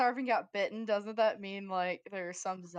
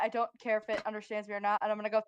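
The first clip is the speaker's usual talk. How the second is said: ordinary delivery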